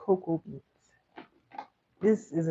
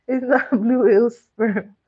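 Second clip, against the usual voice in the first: laughing loud protest; 5 semitones higher, 10 dB louder than usual. Real, not acted. Laughing at the mix-up.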